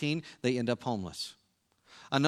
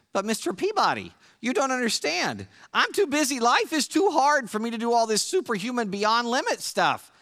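much higher, whiny cartoon boy; Sherman voice; 10 semitones higher, 4 dB louder than usual. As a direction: whine it like Sherman pleading with Mr. Peabody. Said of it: "in your, uh, Sherman accent".

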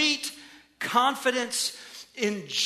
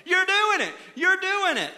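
high-pitched imitation of yelling from afar; mock encouraging yell; 8 semitones higher than usual. Dad calling from the driveway to his kids, mock encouraging yell. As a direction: call it out high, like a cheering parent. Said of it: "I'm yelling out".

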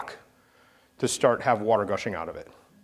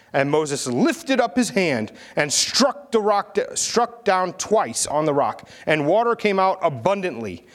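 measured, solemn Bible reading aloud; scripture reading; 3 semitones higher than usual. Acted. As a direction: read the passage to the congregation with weight.

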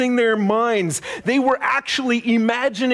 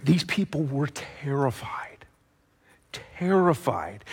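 softer, hushed whisper of dread; 5 semitones lower, 6 dB quieter than usual. Shown as a demonstration. Preacher tells the children the people were terrified, hushed whisper. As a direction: whisper it like a secret fear.

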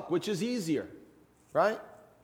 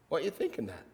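dropped to a soft, hushed repeat; quiet repeat; 11 dB quieter, much faster than usual. Pretend. Jesus asks the room again, hushed and soft. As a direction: let the words fall away softly.

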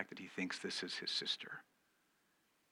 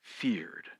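whispered word; 4 semitones lower, 4 dB quieter than usual. Shown as a demonstration. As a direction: whisper "feared" low and soft. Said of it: "Underline that word".

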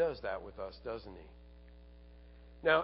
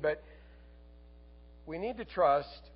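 ordinary delivery

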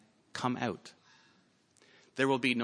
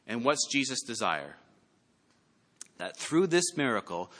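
ordinary delivery

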